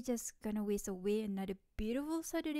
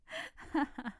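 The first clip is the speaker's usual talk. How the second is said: teasing giggle; 3 semitones higher than usual. Acted. Teasing friend in lap.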